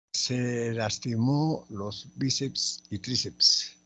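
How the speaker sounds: slow, measured teacher's dictation. Shown as a demonstration. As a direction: read the sentence slowly, word by word. Reading out an exercise sentence.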